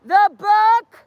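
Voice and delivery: high, loud, slow street callout; drawn-out, projected protest yell; protest shout. Real, not acted. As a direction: bellow each word to the street. Shouts book plug to the street.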